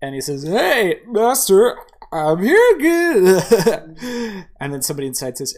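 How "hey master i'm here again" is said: put-on loud, high, drawn-out drunken greeting; drunk man. Drunk regular hailing the food cart master, boisterous. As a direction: play a boisterous drunk, stretching the last words. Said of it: "the drunk man walks up to it".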